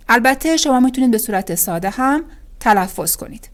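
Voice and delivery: ordinary talk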